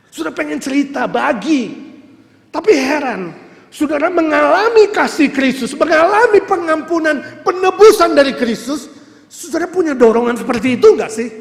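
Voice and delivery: impassioned sermon delivery; full-force preaching. Real, not acted. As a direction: press the question on them loudly.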